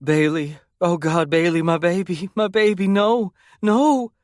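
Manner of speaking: high, panicked mother's scream, climbing; desperate high wail that keeps climbing; screaming in anguish. A narrator's reading. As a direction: cry out for your hurt child, rising into terror. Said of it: "her screams hitting the stifled air".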